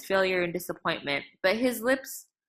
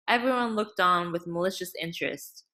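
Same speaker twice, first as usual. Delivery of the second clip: ordinary delivery, nothing marked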